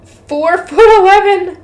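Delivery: high, amazed reaction; real astonishment. Real, not acted. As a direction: blurt it out in amused shock. Reader amazed by the converted height.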